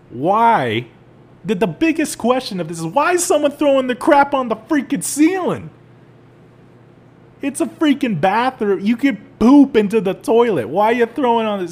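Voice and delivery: rant, up in a squeaky outraged register; incredulous rant. Real, not acted. Disgusted rant about ceiling.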